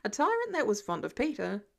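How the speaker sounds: plain reading voice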